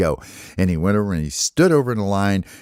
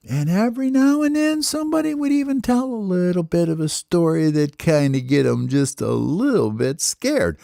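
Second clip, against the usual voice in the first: high, lilting story-time voice; storytelling lilt; 10 semitones higher than usual. A narrator's reading. Narrator telling kids about campfire stories, lilting. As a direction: read it like a bedtime story for kids.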